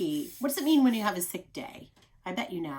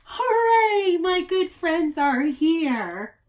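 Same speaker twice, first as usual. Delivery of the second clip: loud high hooray, slowing into glad words; joyful cheer; 9 semitones higher, 10 dB louder, much slower than usual. Acted. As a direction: cheer with delight. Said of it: "said Amos".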